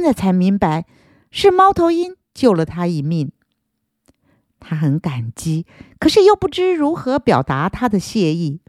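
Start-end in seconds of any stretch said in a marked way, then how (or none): none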